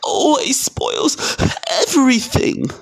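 high, slow fake wail; hammed-up bawling child; pretend sobbing. A narrator's reading. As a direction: whine it through put-on sobs. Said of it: "yelled between huge pretend sobs".

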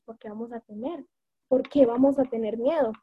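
ordinary delivery

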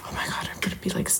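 quick whisper under the breath; hushed, hurried self-reproach; whispered aside. Real, not acted. Stumbles, mutters aside to herself.

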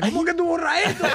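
high, laughing mock alarm; worried old parent. Parent fretting about the church group photo, high mock alarm. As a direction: play the parent panicking about the deleted photo. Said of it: "siempre vienen preocupados".

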